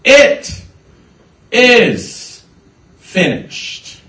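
high, loud, drawn-out declaration, word by word; loud stage Jesus, falling away on the last word; Jesus on the cross. Acted. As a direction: cry it out one word at a time with long gaps. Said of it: "Peter's line after Jesus said".

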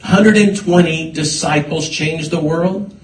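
impassioned preaching, hitting each phrase hard; forceful preaching. Real, not acted. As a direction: build it with punched repetitions, like a preacher.

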